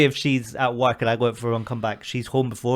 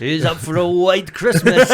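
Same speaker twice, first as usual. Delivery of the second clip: louder, slower, higher mock shady offer; sly dealer offer; 5 semitones higher, 5 dB louder, slower than usual. Offering a fake bag to the group, slow mock dealer. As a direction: tempt them like a dodgy dealer.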